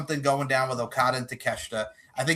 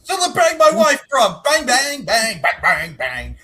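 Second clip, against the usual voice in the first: high, loud hammed-up chant; mock wrestler promo; 8 semitones higher, 7 dB louder, slower than usual. Host joins in the mockery, high and loud. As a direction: belt it out mockingly, bang bang.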